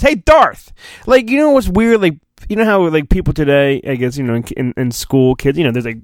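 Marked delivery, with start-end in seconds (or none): 0.0-0.7 high, slightly louder hail; a playful shout across the room; mock calling out. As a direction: call the name up high to get his attention. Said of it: "No one calls".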